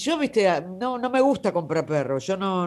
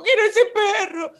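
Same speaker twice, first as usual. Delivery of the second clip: high, pleading mother voice, much higher; imitating mom; 13 semitones higher, 4 dB louder than usual. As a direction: mimic her mother begging for the puppy, pitched high. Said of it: "en una jaula y me dijo". (imitando a la mamá, voz aguda y suplicante)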